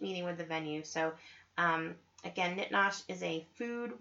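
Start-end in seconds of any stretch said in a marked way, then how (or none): none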